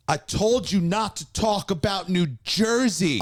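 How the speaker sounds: high, slow scolding shout; mock furious woman. A woman confronting the comic, mock outraged shriek. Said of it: "She goes".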